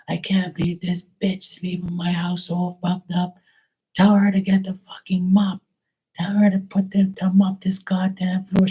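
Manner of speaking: high, irate mother's voice ranting about the dirty house; a furious mom fed up with her messy daughter; mad mother. Retold or imitated. Dead mother furious at her daughter's filthy house, high and livid. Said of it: "the mother was so mad".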